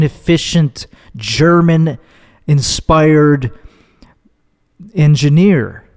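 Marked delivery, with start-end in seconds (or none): none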